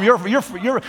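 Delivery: usual speaking voice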